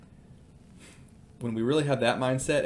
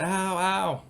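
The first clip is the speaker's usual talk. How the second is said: higher-pitched imitation of a child's pained cry; mock crying yelp; 8 semitones higher than usual. Dad retelling his daughter touching the grill, mock crying yelp. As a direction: yelp like a hurt kid, not at full volume. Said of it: "screams and cries and says".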